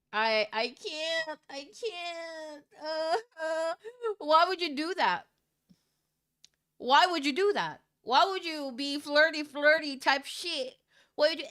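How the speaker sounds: high, whiny fake crying; wailing in despair; mock distraught wail. Real, not acted. Distraught reaction to twist.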